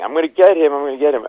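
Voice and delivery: high, loud furious yell of revenge; mock-furious yell; angry threat. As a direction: shout it like a man out for blood. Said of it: "standing there saying".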